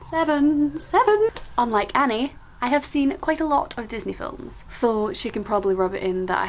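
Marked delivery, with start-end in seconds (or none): 0.1-1.3 rising singsong. Pretend. Rising playful repeats of number.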